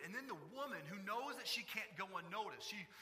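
ordinary talk

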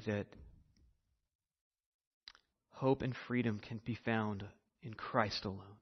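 soft, low, earnest prayer to the Lord; closing prayer. Real, not acted. Pastor leads the church in hushed prayer. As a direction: pray quietly on behalf of the congregation.

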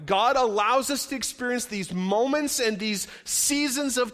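fast, loud, rising pulpit preaching; full-force preaching. Acted. Pastor preaching to his church, full force and loud. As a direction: drive the point home to the congregation.

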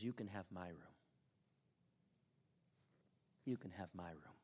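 soft, low, hushed compassion; low and tender, with long pauses; tender offer. Retold or imitated. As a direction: offer it gently, quietly moved. Moved boy offers Mary his room.

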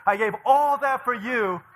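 high, loud, drawn-out mock outrage; angry outburst. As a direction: wail the sacrifice in hammed-up fury. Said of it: "I was really angry at him".

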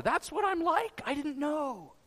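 high-pitched, stunned realization; retold astonishment, voice lifted high; shaken disbelief. Man admitting how his own recording shook him, shaken disbelief. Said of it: "It shook me to my core".

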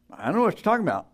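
Peter's second denial, flustered. Retold or imitated. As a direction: wave it away nervously. Peter denies again.

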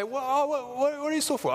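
high, eager fake concern. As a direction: ask with mock interest. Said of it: "they want you to say".

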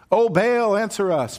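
high pleading cry to a god; crying out to Baal. Acted. False prophets crying to Baal, high and loud. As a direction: call up to the sky, desperate.

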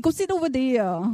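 imitated Vietnamese-accented English. Salon lady telling a customer where to sit, imitated Vietnamese accent. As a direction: put on a rough Vietnamese accent. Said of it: "My accents, right?".

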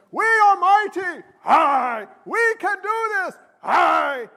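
high-pitched British commander rallying troops; hammed-up British war speech; British accent battle cry. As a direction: call out to the army in a British accent. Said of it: "Always a British accent with me".

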